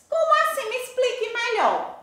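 high-pitched voice of a confused pupil; puzzled student. Acted. Pupil asks the teacher to explain, high puzzled voice.

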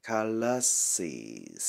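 "Calluses" drawn out in three syllables; teacher slowly sounding out "Calluses". Shown as a demonstration. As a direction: stretch "Calluses" as ca-llu-ses. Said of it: "Cái chỗ bị chai á là".

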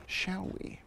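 whispered, playful invitation; soft hushed shall we. Real, not acted. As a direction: breathe it, no voice. Sneaking along the trail.

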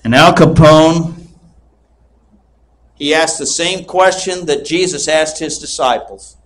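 ordinary talk

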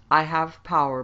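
loud, commanding boast; mocking. A narrator's reading. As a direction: gloat over your victims in a strong voice. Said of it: "mocked the tormentor".

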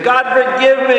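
high, loud cry for forgiveness; acted tearful begging to God; crying plea. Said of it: "and he's crying".

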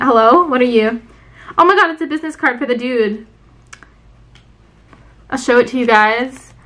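usual speaking voice

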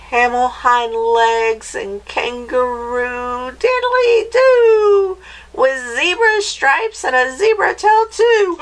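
rises to a very high screech; screechy witch. Acted. Witch adding animal parts to the dog, screechy voice. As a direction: chant it as a shrieking witch. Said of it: "in her high, screechy voice".

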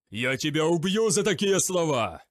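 loud, menacing growl; growled threat. A narrator's reading. Mitris threatens the prisoner, growling. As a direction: threaten to kill him. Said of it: "прорычал Митрис".

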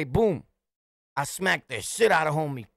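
usual speaking voice

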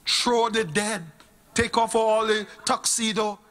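normal talking voice

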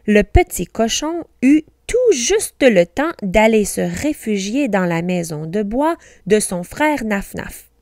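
ordinary delivery

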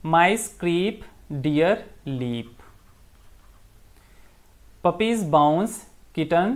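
very slow, word-by-word recital; teacher recites the animal rhyme slowly so students can fill in blanks. Acted. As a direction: spell out each line of the rhyme slowly. Teacher reads a rhyme line by line.

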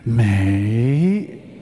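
"Mei" stretched out low, gliding up at the end; Chinese intonation. Shown as a demonstration. Teaching the audience the Chinese way of saying it, long rising "Mei". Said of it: "the intonation is mei".

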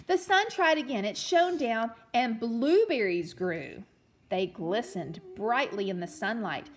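put-on storytelling read-aloud for children; gentle read-aloud storyteller; story narration. A narrator's reading. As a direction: read it like a picture book to a class.

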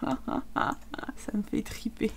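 breathless whisper through laughter; whispered laughing. Real, not acted. Gamer cracking up quietly at his own video, whispered laugh. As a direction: giggle it out under your breath.

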